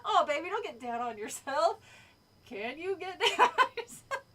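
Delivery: cartoon impression breaking into laughter; Carl. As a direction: quote the catchphrase, cracking up at the end. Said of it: "lines that Carl says".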